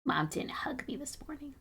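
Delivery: sulky whisper for the cat; voicing the cat, a moping whisper; whispered cat pout. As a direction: breathe it out like a pouting cat. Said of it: "She's like".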